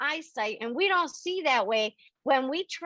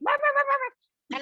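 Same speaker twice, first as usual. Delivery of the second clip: high yapping barks, imitating her dog; barking like a dog; 14 semitones higher than usual. Medium angrily barking back at her noisy dog. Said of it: "and I started barking".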